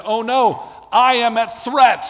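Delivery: high, loud cry of alarm at the threat. Acted. Preacher voicing the church as it cries out in alarm. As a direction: sound the alarm as if the danger is your own.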